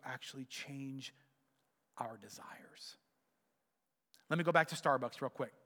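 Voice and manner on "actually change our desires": hushed emphasis; whispered. Real, not acted. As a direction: whisper the last words. Sermon point, hushed ending.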